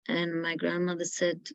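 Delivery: ordinary talk, nothing marked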